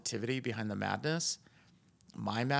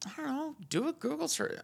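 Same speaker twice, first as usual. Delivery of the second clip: exasperated. Real, not acted. Continuing exasperated rant.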